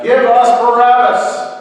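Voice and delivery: high, full-volume crowd shout; shouted demand. A narrator's reading. Crowd chooses whom Pilate should release, shouting. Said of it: "They shouted out".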